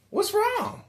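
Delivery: very high, loud question; alarmed bystander. Retold or imitated. Storyteller replays asking a fallen man, alarmed. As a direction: call out in exaggerated concern.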